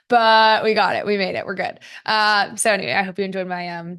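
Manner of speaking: ordinary talk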